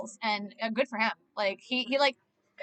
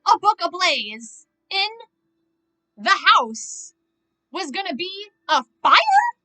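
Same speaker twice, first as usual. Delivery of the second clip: louder and higher, slowed, almost crying with disbelief; exasperated yell; 5 semitones higher, 7 dB louder, slower than usual. Real, not acted. Yelling at the woman who set the fire, exasperated. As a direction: shout at her through the screen.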